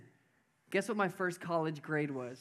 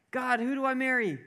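high-pitched mock plea to God; imitated pleading seeker; 7 semitones higher, 3 dB louder than usual. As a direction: act out an anxious question to heaven. Voices a person asking God.

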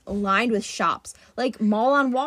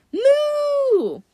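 high, loud, drawn-out moo; cow moo; 6 dB louder than usual. Acted. Cow serpent answers.

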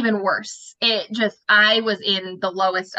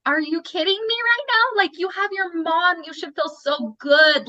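much higher, incredulous outburst; pissed off disbelief; 8 semitones higher than usual. Retold or imitated. To a friend whining about her nails, indignant disbelief. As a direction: let the resentment burst out in disbelief. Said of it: "I was pissed".

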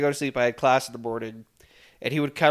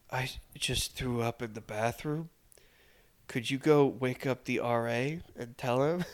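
imitating drunk roommate; 2 semitones lower, 11 dB quieter than usual. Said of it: "And he goes".

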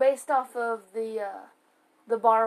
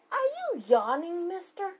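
mock scolding; 7 semitones higher than usual. Real, not acted. Catching partner yawning.

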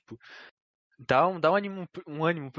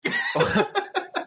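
high filtered laugh. Pretend. Laugh through voice changer.

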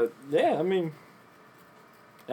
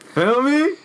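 hyped shout; 13 semitones higher, 6 dB louder than usual. Real, not acted. Hype sign-off.

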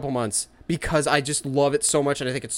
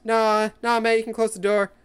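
put-on lightly higher teen voice; casual teen brush-off; 6 semitones higher than usual. Fan imitating Peter brushing off his aunt, casual. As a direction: wave someone off like a kid. Imitating Spider-Man at home.

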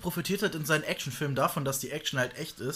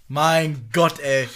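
laut über sich selbst genervt; 9 dB louder, much slower than usual. Real, not acted. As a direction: frustrierter Ausruf über den Versprecher. Ärgert sich über Versprecher. (lauter, genervter Stoßseufzer)

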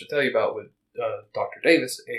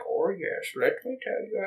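put-on old shopkeeper voice; 7 semitones higher, 6 dB quieter than usual. Voicing old Davis in store.

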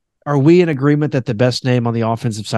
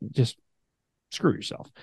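ordinary talk

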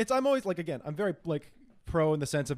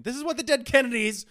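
excited; 7 semitones higher, 6 dB louder than usual. Real, not acted. Excited punk reference.

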